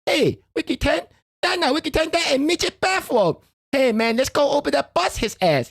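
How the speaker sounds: high, scoffing quoted voice; movie character quote. Retold or imitated. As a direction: scoff up high, then urge the fight.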